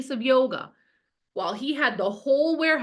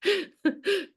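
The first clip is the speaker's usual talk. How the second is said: high laugh; 10 semitones higher than usual. Real, not acted. Laughs at comment.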